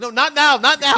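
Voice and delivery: loud, high mock protest; exasperated rebuff. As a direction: cut off an intruder, voice jumping up. Acted rebuff of an untimely texter.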